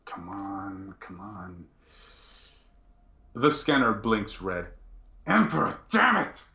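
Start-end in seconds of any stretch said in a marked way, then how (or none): 0.0-1.6 very soft muttering to himself; hushed, impatient repeated mutter. Acted. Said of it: "you hear him muttering". 5.3-6.4 high-pitched outburst of frustration; exasperated oath that climbs sharply; frustrated curse. Acted. Inquisitor curses as scanner fails.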